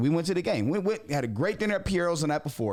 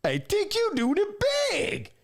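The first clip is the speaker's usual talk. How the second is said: imitating Big Ant; 10 semitones higher than usual. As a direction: play the excited friend calling in. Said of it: "and goes".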